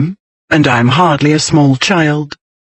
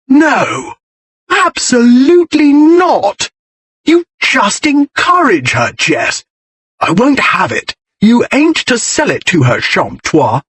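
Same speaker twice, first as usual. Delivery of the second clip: high, outraged shout, slowed on absolutely; bratty brother yelling a flat refusal; 9 semitones higher, slower than usual. A narrator's reading. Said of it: "Bertie shouted".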